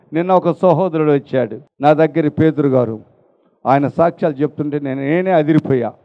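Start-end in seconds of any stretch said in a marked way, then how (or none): none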